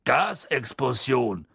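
grumbled. Acted. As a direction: grunt it out flatly. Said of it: "brummte Ernie".

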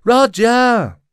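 high, loud, drawn-out shout; calling out. A narrator's reading. Lyra searches for Roger in the crowd, loud high call. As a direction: shout the name across a crowd, pitched high. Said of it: "rief Lyra".

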